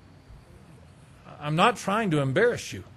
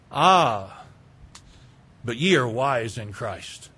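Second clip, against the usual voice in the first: sarcastic; 9 semitones lower, slower than usual. Acted. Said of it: "implementing a little bit of sarcasm".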